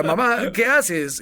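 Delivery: agudo y lento, quejándose; queja. Retold or imitated. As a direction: imita al niño reclamando a mamá, arrastrado. Hijo quejándose a su madre. (queja aguda y lenta de hijo)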